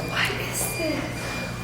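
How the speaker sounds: slow, hushed whisper. Real, not acted. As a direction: ask it under your breath. Ghost hunt, unsettled.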